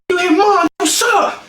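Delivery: high-pitched indignant challenge; hammed-up outraged yelp; mock confrontation. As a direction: square up to a brother, acted out. Acts out confronting brother.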